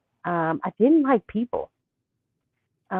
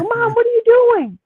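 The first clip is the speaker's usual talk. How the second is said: high-pitched alarmed question to mom; retold alarm, pitched way up and louder; startled confusion; 6 dB louder than usual. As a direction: squeak it out like a confused kid.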